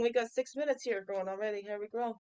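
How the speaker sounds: ordinary delivery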